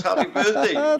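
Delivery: bright, high birthday cheer; excited. Real, not acted. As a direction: burst out with delighted surprise. Learns it is host's birthday.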